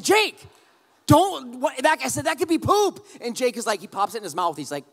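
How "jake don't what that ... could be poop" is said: high, loud panicked outburst; urgent, high-pitched retold yelp of warning; alarmed dad. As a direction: cry out in alarm to stop your child. Father alarmed as son eats find.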